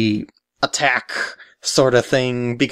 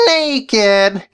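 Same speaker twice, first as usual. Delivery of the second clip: high, sly villain voice; evil voice guy; 6 semitones higher than usual. Acted. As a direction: scheme like a cartoon baddie. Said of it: "why did you call me evil voice guy".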